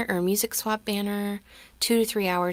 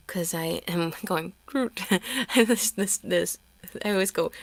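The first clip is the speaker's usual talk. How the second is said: ordinary talk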